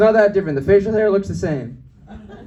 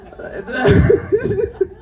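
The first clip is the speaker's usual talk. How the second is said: high-pitched laugh; 12 semitones higher, 3 dB louder than usual. Real, not acted. Comedian laughs after his train story, high laugh.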